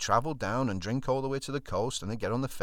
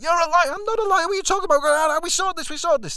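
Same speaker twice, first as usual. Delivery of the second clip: high, loud mock screaming row; shouting match; 11 dB louder than usual. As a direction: yell back and forth in a falsetto. Shouted row through locked door.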